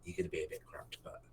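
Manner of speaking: fast, hushed whisper. Acted. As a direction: whisper it quickly so nobody hears. Sneaking party past giants.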